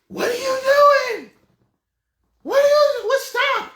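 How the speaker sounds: shrill, loud, panicked "what are you doing"; shocked high shriek. Retold or imitated. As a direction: shriek it out in sudden alarm. Woman shocked mid-hookup.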